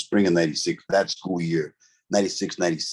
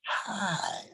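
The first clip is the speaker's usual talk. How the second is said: soft, higher-pitched sultry greeting; sensual; 9 semitones higher, 9 dB quieter than usual. Retold or imitated. Woman answering the inmate's phone call, imitated as sensual. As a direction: breathe out a hushed, seductive imitation. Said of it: "the voice was so sensual".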